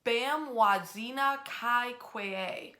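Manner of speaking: Bamwazina Kai Kwee said slowly, each syllable spelled out with care; slow, deliberate try at Bamwazina Kai Kwee, unsure of the sounds; careful Ojibwe pronunciation. Shown as a demonstration. Host tries the Ojibwe name for viewers, slow and careful. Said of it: "I think I am pronouncing it close to co-, correct when I say".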